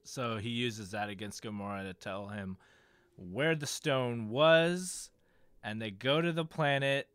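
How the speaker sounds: normal talking voice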